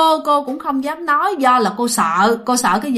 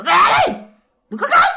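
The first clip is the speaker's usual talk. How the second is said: very high, sharp scolding cry; retold yelled order; 11 semitones higher, 4 dB louder, faster than usual. Woman ordering crying children out, high yelled command. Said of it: "Bà kêu".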